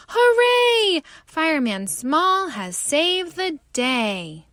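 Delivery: high, loud hooray; cheering shout. Acted. Hippopotamus cheering the fireman. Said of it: "Hippopotamus shouts".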